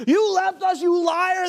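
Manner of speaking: booing fans screaming at the man who left; angry yelling crowd. Retold or imitated. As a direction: yell it like an angry sports crowd. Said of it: "They're yelling all these crazy things".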